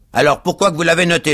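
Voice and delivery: fast, loud, high challenge; accusing cab driver. A narrator's reading. Cab driver challenges Pickwick, fast and accusing. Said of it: "demanda le cocher".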